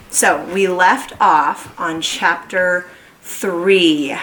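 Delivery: ordinary delivery, nothing marked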